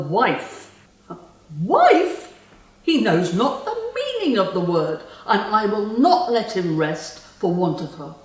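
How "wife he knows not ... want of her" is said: jealous outrage. Acted. Spurned lover vowing he will not rest, jealous and furious. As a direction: spit out the rival's title, outraged.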